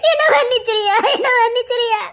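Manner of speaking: high, tearful and laughing; laughing through tears. Acted. Mother to trusting son, laughing through tears. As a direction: relief spilling out as sobbing laughter.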